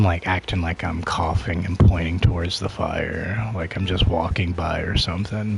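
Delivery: usual speaking voice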